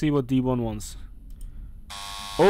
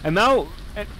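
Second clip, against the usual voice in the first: ordinary talk, nothing marked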